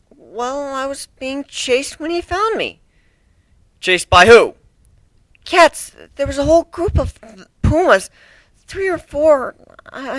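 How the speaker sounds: put-on scared young griffin testifying; timid griffin. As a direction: answer meekly, afraid.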